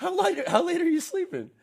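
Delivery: high, laughing disbelief. Real, not acted. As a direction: tease him through a laugh. Teasing friend about sleeping late.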